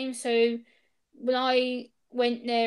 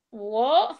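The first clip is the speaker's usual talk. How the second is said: retold baffled "What?", rising at the end; taken aback; 4 dB louder than usual. Replying to officer who calls her threatening, taken aback. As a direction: act out a startled query, voice lifting.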